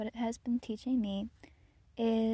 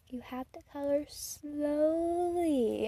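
put-on slow, higher, instructing child; bossy little girl; 4 semitones higher, much slower than usual. Girl teaching her to colour, slow bossy child. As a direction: lecture like a tiny teacher. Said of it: "then she was like".

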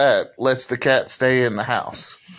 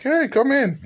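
high coaxing call. Retold or imitated. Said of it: "I'm like".

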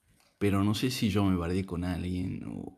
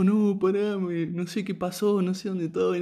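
imitating his drunken weeping, pitched up; retold crying, high-pitched; 10 semitones higher, slower than usual. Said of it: "Me largo a llorar y dice que le digo". (imitando un llanto de borracho, agudo)

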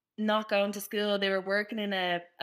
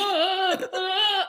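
higher, louder imitated groaning; mock pained groans; 7 semitones higher, 4 dB louder, much slower than usual. Student with stomach ache groaning, mock pained groans. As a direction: groan like a sick teenager.

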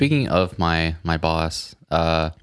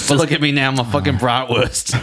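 showing off; 7 semitones higher than usual. Pretend. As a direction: brag like a proud sausage. Boastful sausage brag.

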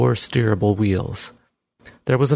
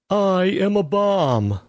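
high, slow machine voice; talking bomb; 10 semitones higher, slower than usual. Acted. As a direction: speak like a cheerful bomb robot. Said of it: "the bomb said to passers-by".

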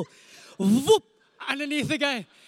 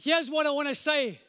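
normal talking voice

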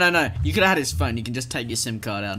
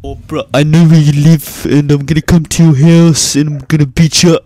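loud, shouty imitation of a bratty kid; mock kid threat; 2 semitones higher, 17 dB louder than usual. Retelling a kid's threatening voicemail, loud mock yell. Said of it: "And he's saying something like".

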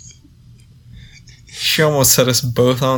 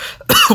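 hammed-up cough. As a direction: cough it up theatrically. Fake coughing fit.

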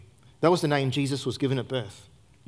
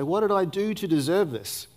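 lightly voiced plaintive question, higher; mock the hurt believer asking why me; imitating a self-pitying sufferer; 5 semitones higher than usual. Preacher voicing a hurting believer, mildly plaintive. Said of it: "and we say".

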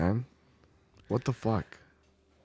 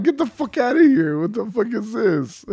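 high, exclaimed disbelief; incredulous protest; 8 semitones higher, 4 dB louder than usual. Real, not acted. Reacting to his friend dodging the story, incredulous outburst. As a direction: scoff loudly, then break into laughter. Scoffing at friend's dodged answer.

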